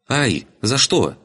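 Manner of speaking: high, drawn-out indignant yelp; yelping girl. Acted. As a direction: cry out as a girl getting smacked. Girl being switched on her bottom. (девичье ойканье с обидой)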